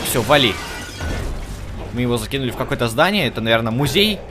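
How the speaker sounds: normal talking voice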